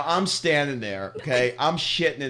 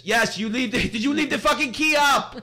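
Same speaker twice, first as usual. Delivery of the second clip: high mock yell, laughing through it; angry Greek shopkeeper; 10 semitones higher, 3 dB louder than usual. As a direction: ham up the furious scooter guy. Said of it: "He says".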